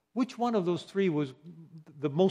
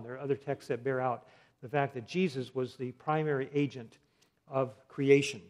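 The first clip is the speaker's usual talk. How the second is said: ordinary talk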